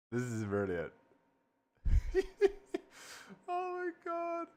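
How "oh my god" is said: high, slow, airy laughing oh my God; wheezing laugh into oh my God; breathless laugh. Real, not acted. Cracking up at result.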